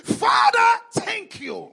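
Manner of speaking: loud, high opening call to Father; crying out in prayer. Acted. Preacher modelling thanks to God, crying out in prayer. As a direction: cry it out, dropping on the thanks.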